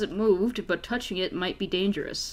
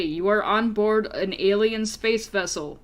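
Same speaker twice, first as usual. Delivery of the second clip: normal talking voice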